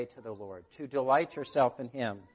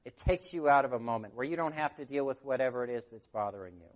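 ordinary talk

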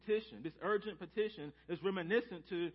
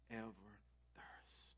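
fading to a whisper; 9 semitones lower, 19 dB quieter, slower than usual. Real, not acted. Quote fades to whisper.

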